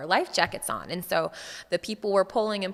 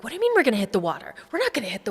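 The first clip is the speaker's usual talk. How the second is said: fast, louder, alarmed protest; panicked disbelief; 4 semitones higher, 4 dB louder, much faster than usual. Retold or imitated. Wife protesting to husband that they won't hit the water, fast and alarmed. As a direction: refuse to believe it, words tumbling out.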